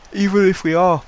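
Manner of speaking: talking through a yawn; words pulled out of shape by a yawn. Real, not acted. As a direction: let a yawn stretch the words. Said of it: "excuse me, yawning".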